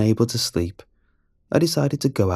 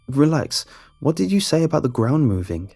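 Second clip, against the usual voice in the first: higher, urgent questioning; eager; 7 semitones higher than usual. Acted. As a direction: press him for an answer. Boy pressing the drunk man.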